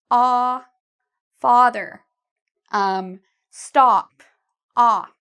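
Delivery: ah held long in father and stop. Shown as a demonstration. Teacher showing learners ah is a long sound. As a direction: hold the ah long. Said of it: "It's actually a long sound".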